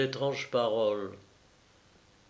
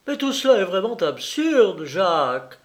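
a touch of shaky fury, a little higher; trembling with anger; 6 semitones higher, 5 dB louder than usual. Acted. Clémence scolding Jacques, mild trembling anger. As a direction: hold back rage as you scold. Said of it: "d'une voix tremblante de colère".

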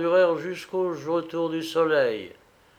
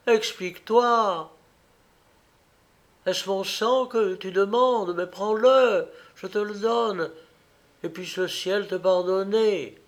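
pleading cry; 5 semitones higher than usual. A narrator's reading. Wife offers her blood to her husband, pleading cry. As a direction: implore him with a touch of desperation. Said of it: "s'écria Gioia".

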